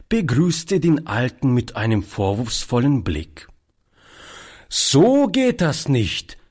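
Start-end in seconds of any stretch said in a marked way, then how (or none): 4.7-6.4 put-on high, slow, knowing child's reproach; precocious. A narrator's reading. Toni reproaches the old man for the closed shop, precociously. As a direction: scold slowly like a child playing grown-up. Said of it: "sagte er altklug".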